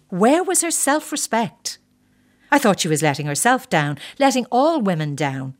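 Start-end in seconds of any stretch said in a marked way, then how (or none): 0.1-1.8 high, drawn-out mock indignation; scoffing. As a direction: scoff at her, slow and high-pitched. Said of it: "I scoffed at other women".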